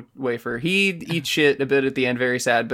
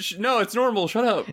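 high, snippy denial; defensive brother; 8 semitones higher than usual. Retold or imitated. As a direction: brush it off pitched up and testy. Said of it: "And then he says".

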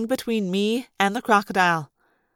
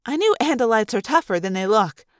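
ordinary delivery, nothing marked

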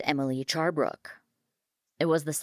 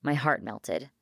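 plain reading voice

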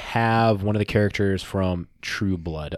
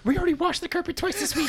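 very high falsetto mom; complaining mom; 4 dB quieter than usual. Acted. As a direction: nag in a squeaky mother voice. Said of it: "His, his mom walking in".

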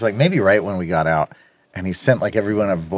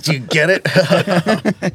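slow, higher dopey cartoon teen; Beavis; 6 semitones higher, much slower than usual. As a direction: drag it out like a dim cartoon teen. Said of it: "a little more Beavis than Butthead".

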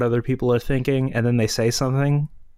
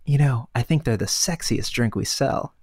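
secretive whisper. A narrator's reading. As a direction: whisper it behind a hand. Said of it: "puts a hand over her mouth".

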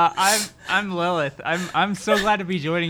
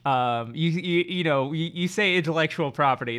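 ordinary talk, nothing marked

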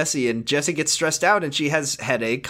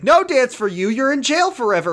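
strict mom voice; 9 semitones higher, 3 dB louder than usual. Acted. Voicing Emily forbidding the dance.

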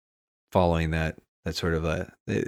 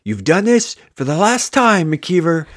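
high, louder mock villain line; dramatic brain voice; 8 semitones higher, 5 dB louder than usual. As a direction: ham up a pompous final warning. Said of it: "it's like".

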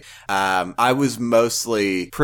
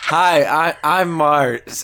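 higher, emotional self-introduction; 5 semitones higher, 3 dB louder than usual. Real, not acted. Podcast host introduces themself to listeners, unexpectedly emotional. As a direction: introduce yourself as if overcome. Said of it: "You sounded so, like, emotional".

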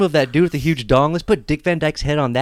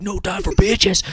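hammed-up macho; 8 semitones higher, slower than usual. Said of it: "He's like".

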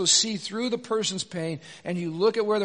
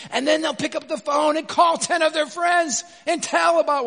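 heated build; 8 semitones higher, 6 dB louder than usual. Acted. Preacher mocking gossips, heated.

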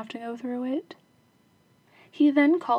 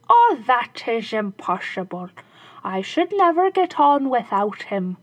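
shoemaker; 7 dB louder than usual. Acted. Shoemaker pretending he can't part with the dog, mock reluctant. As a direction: feign reluctance.

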